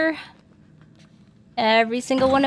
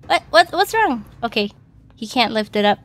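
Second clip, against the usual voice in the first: ordinary talk, nothing marked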